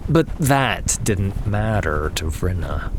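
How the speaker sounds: usual speaking voice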